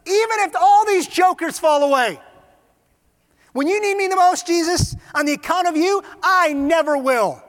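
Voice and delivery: loud, high proclamation; impassioned vow. Acted. Peter boasts to Jesus he will never fall away, impassioned. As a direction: declare it at full voice, drawing out the end.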